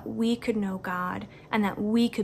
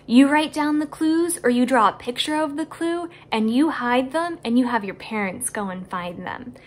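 ordinary talk, nothing marked

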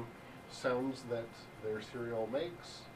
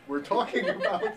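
laughing; 10 semitones higher, 6 dB louder than usual. Real, not acted. Laughs mid-answer.

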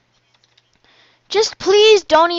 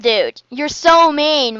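usual speaking voice

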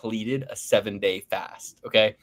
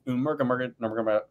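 normal talking voice

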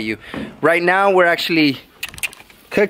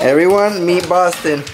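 ordinary talk

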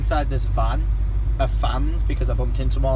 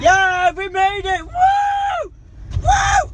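much higher, shouted whoops; excited cheer; 4 dB louder, much slower than usual. Real, not acted. Vlogger cheering arrival with friends, whooping shout. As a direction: celebrate like you've crossed a finish line.

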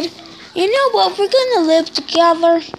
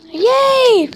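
higher, louder cheer; 7 semitones higher, 5 dB louder than usual. Real, not acted. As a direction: cheer happily. Cheering about grades.

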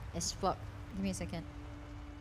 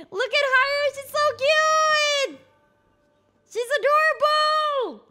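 very high, slow squeal of delight; gushing squeal; 13 semitones higher, 6 dB louder, slower than usual. Real, not acted. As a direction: coo over a cute pet. Gushing over cute character.

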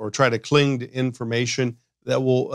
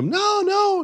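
very high, squeaky denial; voice pitched up. Retold or imitated. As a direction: imitate someone protesting in a falsetto. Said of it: "your voice pitches up".

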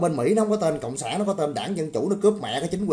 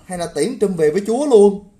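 yelling the dare much louder; angry shout; 5 semitones higher, 10 dB louder than usual. Real, not acted. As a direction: shout it at the trolls, furious. Heated rant daring commenters. (hét lên giận dữ)

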